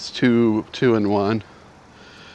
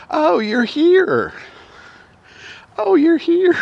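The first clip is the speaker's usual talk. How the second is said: very high, drawn-out mock falsetto; imitated post-surgery grogginess; 5 dB louder, slower than usual. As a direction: imitate someone waking woozily, squeaky-high and loud. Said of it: "So it's the way she put it".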